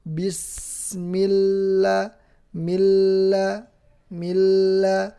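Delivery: bismillah held long and slow, doubled lam in milla; tajwid recitation. Shown as a demonstration. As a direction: recite slowly, lengthening the lam. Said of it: "Karena lamnya bertasydid". (membaca bismillah perlahan dengan lam bertasydid)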